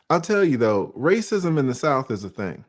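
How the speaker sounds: ordinary talk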